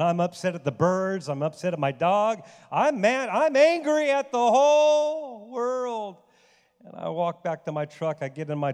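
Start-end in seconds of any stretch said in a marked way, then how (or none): 2.7-6.2 much higher, louder, slowing on the world; retold fury. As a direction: build the rage to a peak. Retelling fury after the fall.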